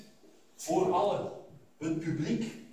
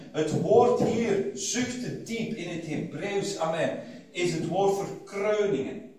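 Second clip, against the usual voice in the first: normal talking voice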